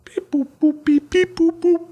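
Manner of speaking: very high beeping robot bleeps; toy robot voice. Pretend. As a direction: chirp it like a little toy robot. Robot sign-off beeps.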